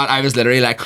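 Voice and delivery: ordinary talk, nothing marked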